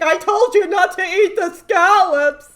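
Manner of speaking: loud, high imitation of a nagging wife; scolding wife. Wife berating husband after scallops, loud high mock scolding. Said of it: "and his wife's like".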